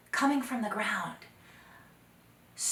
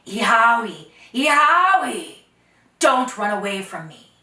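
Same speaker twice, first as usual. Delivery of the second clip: calling out; 2 semitones higher, 16 dB louder than usual. Acted. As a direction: call her back across the plain. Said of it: "calling out".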